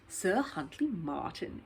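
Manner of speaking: slow, musing repeat of the name; slow echo. Acted. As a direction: echo the name slowly, thinking. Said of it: "echoed Missus Bird".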